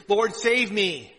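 loud, slow plea for rescue; a drowning man's cry to the Lord; desperate cry. Acted. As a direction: cry it out as if sinking. Peter sinking, calling to Jesus.

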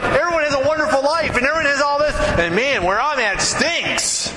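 high, whiny mock complaint; whiny envious voice. Preacher mocking the envious social media user, high whine. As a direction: mimic someone feeling left out.

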